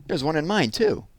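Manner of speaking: put-on high childish whine; a small kid chiming in, grossed out; little boy voice. Little brother at the stand, whiny high voice. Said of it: "her brother said".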